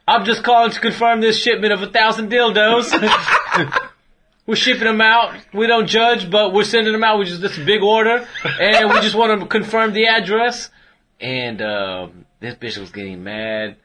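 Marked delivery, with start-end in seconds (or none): 0.1-2.9 mock English accent through laughter; laughing while faking an English accent. Prank caller confirming a shipment, mock English accent. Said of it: "it's hard to keep up that accent now". 4.5-10.7 hammed-up English accent, higher. Prank caller to the wife, mock English accent. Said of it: "it's hard to keep up that accent now".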